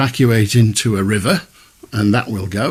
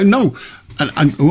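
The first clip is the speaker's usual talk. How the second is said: normal talking voice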